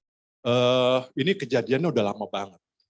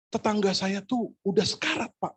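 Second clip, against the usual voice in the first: high-pitched imitation of a worried caller; acting out urgent news on the phone; alarmed caller; 9 semitones higher than usual. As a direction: report the emergency in a raised pitch. Alarmed caller reports dying neighbour.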